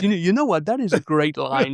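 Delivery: lightly voiced Alan Alda aside; a mild hint of Alan Alda pleased with himself. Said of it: "stops and goes".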